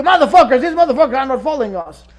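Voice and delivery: loud, fast cursing outburst; mock angry rant. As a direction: snap into a furious rant. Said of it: "But then I go and tell you".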